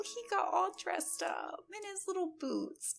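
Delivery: high, breathy emotional whisper; tearful whisper. Real, not acted. Overcome at cute sight.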